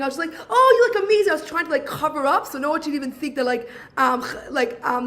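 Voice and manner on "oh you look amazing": higher, louder put-on gushing; mock cheerful compliment. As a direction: gush brightly to hide the hurt. Covering up at sister's wedding.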